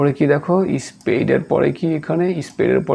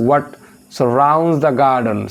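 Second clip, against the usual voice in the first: drawn-out answer option; teacher reading options aloud; very slow reading; much slower than usual. Acted. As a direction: read each option out slowly. Reading exam question.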